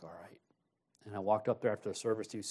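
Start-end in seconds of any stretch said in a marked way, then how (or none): 0.0-0.4 low, very soft acceptance; small, subdued retold reply; quiet compliance. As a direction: give in under your breath. Retold giving in to the Lord.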